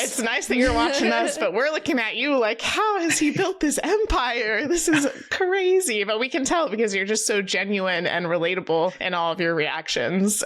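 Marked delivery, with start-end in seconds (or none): 2.6-6.0 slow, high-pitched disbelief; marvelling, pitched well up; amazed. Retold or imitated. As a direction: stretch it out in playful astonishment. Retells amazement at his channel's size.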